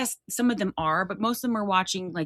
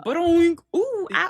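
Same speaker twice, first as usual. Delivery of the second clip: cartoon sound effects; 12 semitones higher, 5 dB louder than usual. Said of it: "a lot of it is like".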